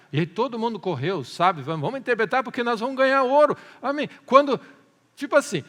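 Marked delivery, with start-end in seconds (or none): 1.6-3.6 high, loud, rushed chatter; mock excitement, quick and raised; eager crowd, imitated. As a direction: blurt it like people racing for a prize. Acted crowd rushing for reward.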